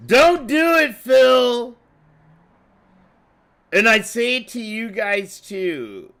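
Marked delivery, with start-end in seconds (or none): 0.1-1.8 higher, louder urgent plea; loud warning. Real, not acted. Streamer urging friend not to reroll, loud warning. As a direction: stop him before he rolls.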